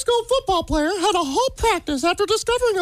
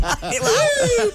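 showing-off whoop; 3 semitones higher than usual. Shown as a demonstration. Guest shows off a second talent to hosts, silly whoop. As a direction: silly whoop through laughter. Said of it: "two things I got. Mickey Mouse and whoop".

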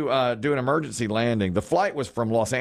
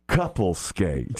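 slow, lower announcer call over a rink PA; loudspeaker announcer; 3 semitones lower, much slower than usual. Retold or imitated. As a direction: drawl it out like a roller rink DJ. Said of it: "the guy in the loudspeaker goes".

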